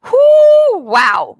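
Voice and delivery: high, loud "whoo" falling into a wow; imitated overwhelmed whoop; retold alarm. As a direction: act out being thrown into worry. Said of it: "like, a fear zone".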